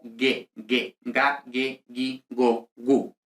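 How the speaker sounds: "ge, gi" said ghe, ghee; every g in "ga, ge, gi, go, gu" hard, never Spanish j; hard G sound. Shown as a demonstration. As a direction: recite "ga, ge, gi, go, gu" with hard g throughout. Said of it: "La G siempre suena como G".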